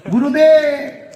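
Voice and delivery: long-drawn, high, loud call; reverent hailing cry. Retold or imitated. Exorcists falling at the new guru's feet, loud adoring cry. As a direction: hail the master with one stretched-out cry.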